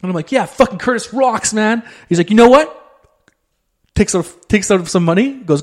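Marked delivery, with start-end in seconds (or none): 0.3-1.8 higher, slow drawn-out hype; stoked fan. Retold or imitated. To his friend about the street guitarist, slow higher hype. 2.3-2.8 much higher and louder, building up; big announcement. Retold or imitated. Friend about to tip the guitarist, loud high reveal.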